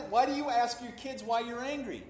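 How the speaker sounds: normal talking voice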